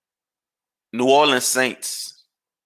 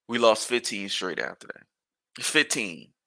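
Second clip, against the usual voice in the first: ordinary talk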